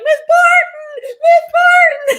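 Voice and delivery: high, loud, drawn-out call of her name; slow sing-song yell through the door; kids calling out. Retold or imitated. Students calling their teacher through the locked door, loud and high.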